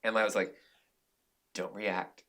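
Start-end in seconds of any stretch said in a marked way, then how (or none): 1.5-2.2 soft, slowed-down inner voice talking himself down; calm self-talk. Retold or imitated. Director coaching himself not to snap, quiet and steady.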